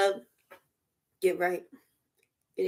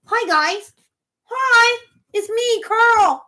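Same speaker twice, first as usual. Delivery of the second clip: much higher, squeaky-sweet greeting; mock someone's high-pitched hello; put-on high chirpy intro; 9 semitones higher, 4 dB louder, much slower than usual. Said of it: "your voice sound like this".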